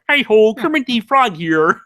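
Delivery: bright Kermit-style greeting; Kermit the Frog impression. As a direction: chirp it like a cheery frog puppet. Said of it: "a decent Kermit the Frog".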